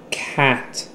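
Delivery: cat drawn out slowly, each sound separated but natural; slow deliberate articulation. Shown as a demonstration. Teacher modelling cat slowly for a student, slow and deliberate. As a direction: say cat slowly so the child hears every sound. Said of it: "slowly and deliberately articulate the word".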